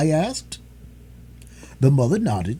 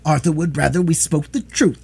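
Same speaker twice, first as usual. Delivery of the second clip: higher, louder, resolute reply; firm; 5 semitones higher, 4 dB louder than usual. A narrator's reading. Daughter answering her distraught mother firmly. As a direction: stand your ground. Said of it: "the girl answered firmly".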